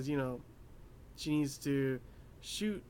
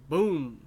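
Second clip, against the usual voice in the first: a sudden loud boom for the shot; gunshot boom; 9 dB louder than usual. Retold or imitated. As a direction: punch out the gunshot sound. Recapping a gunshot scene.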